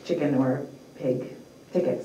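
ordinary delivery

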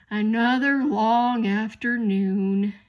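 slow, loud, drawn-out grumble; grumbling. A narrator's reading. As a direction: grumble it out wearily, stretching each word. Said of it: "and grumbled softly".

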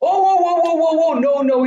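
high, loud startled cries; alarmed whoa. Real, not acted. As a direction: panic as the enemy rushes in. Sudden danger in game.

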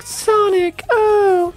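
very high lovestruck sigh; swooning Amy. Retold or imitated. Gamer mocking Amy swooning over Sonic, squeaky. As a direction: pine for him like a cartoon fangirl. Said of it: "other than be like".

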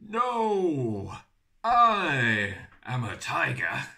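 loud insistence. Acted. Mouse roars its claim.

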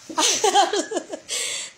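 overwhelmed, helpless laughing; real laugh. Real, not acted. Overwhelmed by viewers' gifts.

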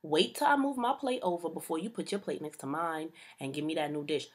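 ordinary talk, nothing marked

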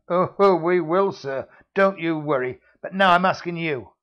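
loud taunting heavy; sneering thug. Acted. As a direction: needle him. Villain threatens.

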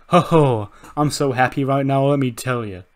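loud, gleeful mic boast; elated wrestler promo. Acted. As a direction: crow to the crowd in high spirits. Acts happy wrestler on the mic.

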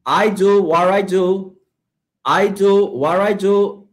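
slow model reading, "what I" as "war-ai"; listen-and-repeat model. Shown as a demonstration. Teacher drilling students, slow model with "what I" as "war-ai". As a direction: say "I do what I do" slowly for repetition. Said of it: "así que listen and repeat".

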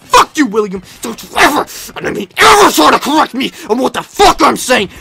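a furious warning; angry shout. Acted. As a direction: bite his head off. Paul snaps back at William.

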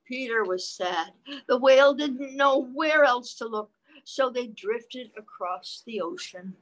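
put-on lively read-aloud voice for children; reading the book to little listeners; story narration. A narrator's reading. Parent reading a picture book to children, lively read-aloud voice.

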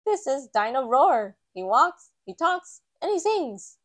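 loud, rising sales pitch; Mister Fox showing off the toy, loud and rising; shopkeeper Mister Fox. A narrator's reading. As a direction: show off the toy like a keen shopkeeper. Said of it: "says Mister Fox".

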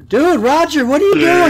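high, loud exclamation; alarmed calling out. Real, not acted. Player questioning Roger's wandering, high loud shout. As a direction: yell at your buddy in disbelief.

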